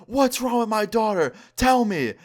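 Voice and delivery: high-pitched imitation of screaming; mock scream. Mom in his face demanding answers, mock scream. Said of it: "starts screaming".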